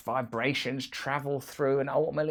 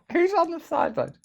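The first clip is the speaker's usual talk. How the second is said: laughing question; 11 semitones higher, 12 dB louder than usual. Real, not acted. Laughs at the tip.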